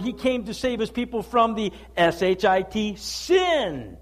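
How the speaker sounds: loud, driving pulpit preaching; full-force preaching. Acted. Pastor preaching hard to the church, loud and driving. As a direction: thunder it out to the congregation.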